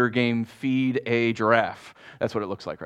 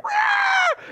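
piercingly high "rah" screech; imitated hawk screech; 4 dB louder than usual. Hawk swooping out of nowhere beside the walker, sudden imitated screech. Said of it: "this hawk out of nowhere is like".

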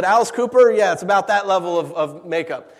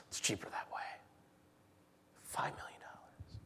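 unvoiced hushed whisper; "Five million dollars" whispered; 23 dB quieter than usual. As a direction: breathe it out in stunned disbelief.

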